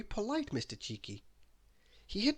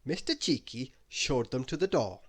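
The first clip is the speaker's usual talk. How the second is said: ordinary delivery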